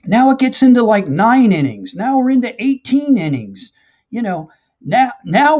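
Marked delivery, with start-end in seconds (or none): none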